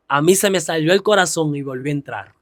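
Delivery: normal talking voice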